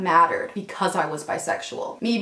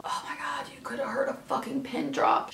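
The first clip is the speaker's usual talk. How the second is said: awed whisper; 5 semitones higher, 9 dB quieter than usual. Real, not acted. Stunned silence after her answer.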